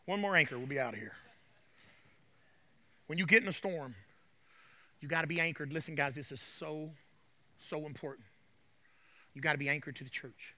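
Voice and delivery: ordinary delivery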